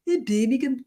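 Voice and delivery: hammed-up Brummie accent; Birmingham accent. Shown as a demonstration. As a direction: put on a bad Birmingham accent. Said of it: "That's my Birmingham accent".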